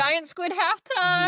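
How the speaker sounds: high, loud, stretched-out cheer; mock halftime hype. Host joking about a lake spectacle, mock stadium hype. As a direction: whoop it like a stadium announcer. Pretend halftime show announcement.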